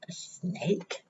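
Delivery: soft whisper; whispered reveal. Real, not acted. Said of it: "reader names next animal".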